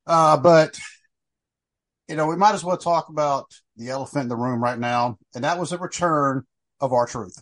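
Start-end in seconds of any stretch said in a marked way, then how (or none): none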